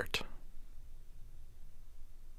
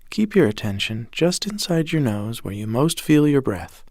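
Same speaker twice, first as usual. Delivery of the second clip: usual speaking voice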